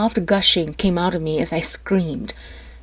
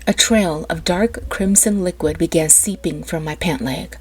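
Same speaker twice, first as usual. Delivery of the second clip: plain reading voice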